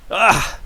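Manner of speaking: loud high disgusted groan; sharp disgusted groan at the screen; disgusted ugh. Real, not acted. Reacting to shocking game moment.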